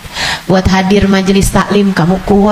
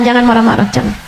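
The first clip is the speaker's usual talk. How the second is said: ordinary delivery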